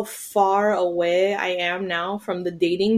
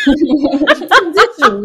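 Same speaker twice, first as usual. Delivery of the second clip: drawn-out laughing announcement; dramatic announcement; 6 semitones higher, 8 dB louder, much slower than usual. Pretend. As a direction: stretch it out grandly. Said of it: "this is my transition".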